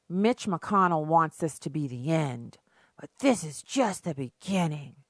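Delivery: put-on drunken voice. Shown as a demonstration. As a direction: read it like a weird drunk. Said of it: "that kind of weird, almost drunken voice".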